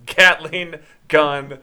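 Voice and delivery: drawn-out, slow "Gatling gun"; slow, weighty reveal; emphatic note reading. Retold or imitated. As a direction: stretch each word in awe. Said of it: "it's just exclamation point".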